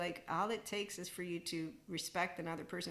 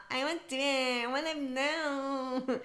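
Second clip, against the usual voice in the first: higher and slow, whining "I wanna know"; slow high mock whine; mock whiny asker; 7 semitones higher, 4 dB louder, slower than usual. Shown as a demonstration. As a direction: sound like a needy follower begging for answers.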